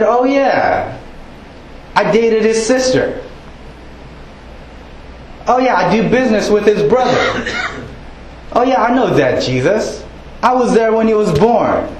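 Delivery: high-pitched, dismissive local chiming in; know-it-all townspeople. Acted. Neighbours claiming they know the family, high-pitched know-it-all.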